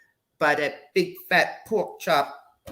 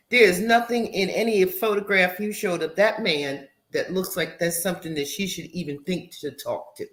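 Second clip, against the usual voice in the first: usual speaking voice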